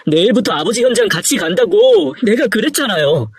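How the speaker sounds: distraught, high-pitched reproach; anguished regret, pitch climbing; grieving lament. Acted. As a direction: mourn a father gone too soon. Son laments to dead father.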